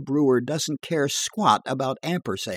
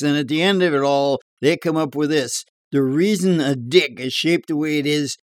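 higher, louder bar yarn voice; tall-tale barfly; 3 semitones higher, 4 dB louder than usual. Acted. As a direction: spin a dirty joke in a bar. Edgar's tall tale, loud.

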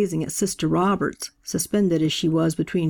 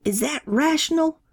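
screamed; 9 semitones higher than usual. A narrator's reading. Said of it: "Brother Robert screamed".

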